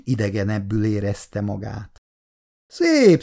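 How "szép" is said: Szép flung up very high and loud; mock-admiring, high Szép; a sarcastic look round the bar; ironic praise. A narrator's reading. Anti looks round the new bistro.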